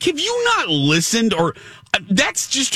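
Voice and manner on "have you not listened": high, disbelieving question; incredulous. Retold or imitated. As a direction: press her in disbelief. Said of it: "I'm like".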